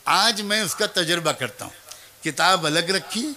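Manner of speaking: usual speaking voice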